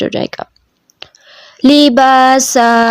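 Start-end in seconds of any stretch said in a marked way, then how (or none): 1.6-2.9 لباسا with the a after ب held long; drawn-out libasa. Shown as a demonstration. Teacher showing the student the long a. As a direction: slowly stretch the middle a of لباسا. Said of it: "دو انگلیاں جتنا لمبا کریں گے".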